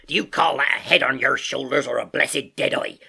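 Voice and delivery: loud, scolding sailor voice; Silver crying out. A narrator's reading. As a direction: berate him as an exasperated landlord. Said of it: "cried Long John".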